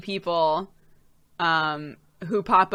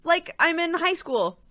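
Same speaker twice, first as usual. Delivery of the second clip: high, whiny complaint; high dismayed whine; 11 semitones higher, 6 dB louder than usual. Retold or imitated. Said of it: "I'm like".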